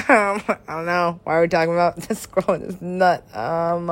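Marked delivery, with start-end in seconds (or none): none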